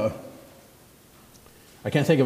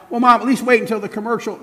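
put-on high, loud teen bargaining; bargaining teenager; 9 semitones higher, 5 dB louder, faster than usual. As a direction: push back for a few more minutes. Arguing teenager.

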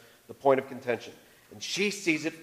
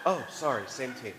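mock apology; 3 semitones lower, 7 dB quieter than usual. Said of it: "She's not sorry".

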